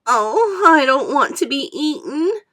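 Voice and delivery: wailing. A narrator's reading. Earthworm wailing about being eaten. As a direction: sob it out in terror. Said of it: "wailed the earthworm".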